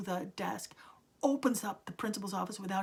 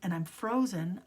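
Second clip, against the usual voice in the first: normal talking voice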